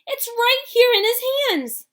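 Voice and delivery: high, loud, frantic yelling; excited shouting. A narrator's reading. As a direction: shout it to the whole shop. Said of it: "screamed the shopkeeper".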